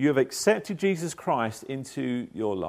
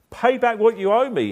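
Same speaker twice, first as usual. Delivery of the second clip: demanding; 8 semitones higher, 5 dB louder than usual. Acted. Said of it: "he demanded".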